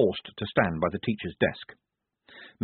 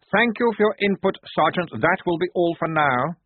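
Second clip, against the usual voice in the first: dismissive officer; 9 semitones higher, 4 dB louder than usual. Acted.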